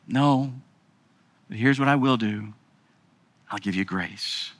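low and soft; quiet reassurance; gentle God voice. Acted. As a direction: offer grace tenderly. God's voice answering.

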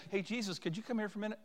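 soft, offhand request; mock nonchalant call; casual asking. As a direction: toss it out like a small favour. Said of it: "They didn't say".

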